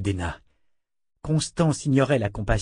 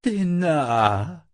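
higher, long wistful sigh of a name; drawn-out, pitched-up sigh; 7 semitones higher, 3 dB louder than usual. A narrator's reading. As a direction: sigh it out, lifting at the end. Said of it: "soupira Constance".

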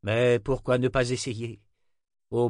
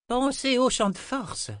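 high-pitched call after someone; raised, high parting reminder. A narrator's reading. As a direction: toss it out to people leaving, voice pitched way up. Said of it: "lança Zed".

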